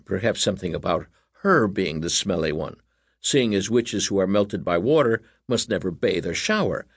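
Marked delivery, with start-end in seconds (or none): none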